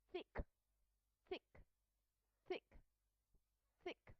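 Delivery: "thick" opening on a voiceless TH; unvoiced TH. Shown as a demonstration. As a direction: say "thick" as a drill word, TH only air. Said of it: "will be pronounced as unvoiced sound".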